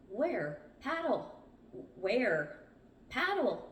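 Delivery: urgent exchange, commands pitched high. A narrator's reading. Crew chasing a sighted raft, urgent. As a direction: call out each word urgently.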